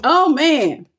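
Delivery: ordinary talk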